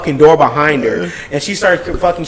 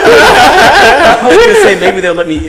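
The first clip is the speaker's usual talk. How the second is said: higher, louder, slow giggle-talk; laughing aside; 8 semitones higher, 7 dB louder, slower than usual. Real, not acted. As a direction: joke while still cracking up. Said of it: "laughing aside".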